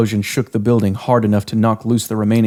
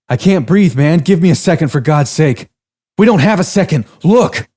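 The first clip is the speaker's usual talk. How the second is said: higher, louder panting argument; winded and desperate to run; breathless urgent men; 7 semitones higher, 4 dB louder than usual. Acted. Two exhausted men argue.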